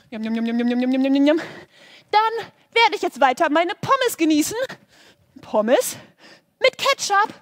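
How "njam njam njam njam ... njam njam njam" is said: loud chewing noises. Acted. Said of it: "so laut geschmatzt".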